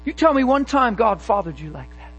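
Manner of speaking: normal talking voice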